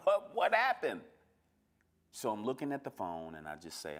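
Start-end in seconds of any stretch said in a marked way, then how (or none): 0.1-1.0 high, loud, startled outburst; jolted, high-pitched bewilderment; startled reaction. Retold or imitated. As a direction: blurt it out high and loud, caught off guard. Acting out a sudden fight call.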